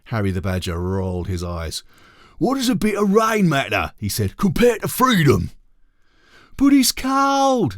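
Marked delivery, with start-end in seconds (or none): 2.4-5.5 high, loud scolding; Harry scolding the gang, high and loud; exasperated badger boss. 6.6-7.8 very high, loud, slow whine; complaining badgers.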